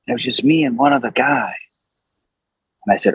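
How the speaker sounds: ordinary delivery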